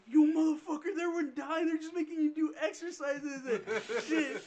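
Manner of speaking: high, loud mock outrage, laugh creeping in; retold scolding. Neighbor scolding the kid he picked up, mock outrage. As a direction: imitate an exasperated telling-off.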